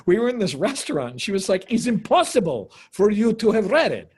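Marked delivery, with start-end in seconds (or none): 1.7-4.1 imitated outrage, high and loud; angry Russian actress. Russian actress arguing with him in a restaurant, angry. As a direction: mimic her indignant insistence. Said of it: "she got so angry at me".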